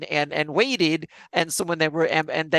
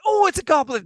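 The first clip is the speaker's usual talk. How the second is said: high, loud mock cry of discovery; excited player; 11 semitones higher, 5 dB louder than usual. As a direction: point and shout it out. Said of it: "the players were like".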